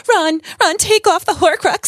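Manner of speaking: high, loud, urgent pleading; hammed-up high-pitched scolding; Hermione. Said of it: "Hermione when she's like".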